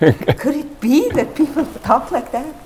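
high, loud, astonished question; hammed-up wonder, high and loud; amazed narrator. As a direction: marvel aloud at how people speak. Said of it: "he says".

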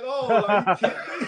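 mock-outraged, quick and laughing; laughing disbelief. Player answering kids who tease him, laughing in mock protest. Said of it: "I'm like".

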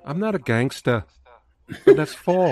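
light impression of the movie's coach; lightly put-on accent, quoting the coach; a hint of an accented movie coach. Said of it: "That's a great impression".

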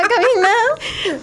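nervous giggly question; nervous laugh. Real, not acted. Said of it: "yo también luego me río de nervios".

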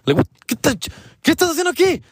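high, startled yell of a bar worker; angry bar guy. Retold or imitated. Bar worker catching a kid inside, high shocked yell. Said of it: "And he's like".

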